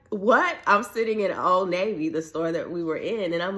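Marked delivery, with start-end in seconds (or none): 0.1-0.6 higher and louder, laughing shock; stunned what. Retold or imitated. Hearing she won a thousand dollars, laughing in shock. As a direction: blurt it out in happy disbelief.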